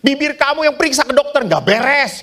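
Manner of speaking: high, fast wife's jab; mock wife telling him to see a doctor; scolding wife. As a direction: rattle it off like a nagging wife.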